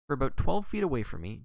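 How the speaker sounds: plain reading voice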